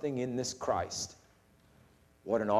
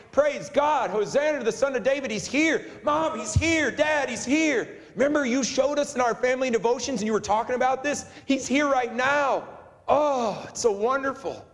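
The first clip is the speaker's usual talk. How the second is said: put-on high-pitched, thrilled children crying out praise; excited kids; 9 semitones higher than usual. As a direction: imitate kids bursting to tell mom and dad. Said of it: "The excitement that these kids had".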